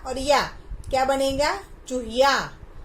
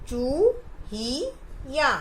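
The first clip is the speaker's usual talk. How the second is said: चू ही या split into separate slow syllables; चू ही या stretched out for the class; much slower than usual. Shown as a demonstration. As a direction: sound out चू, ही, या one by one. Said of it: "ध्यान से सुनिए".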